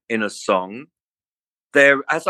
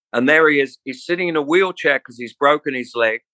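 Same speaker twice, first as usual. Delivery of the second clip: ordinary talk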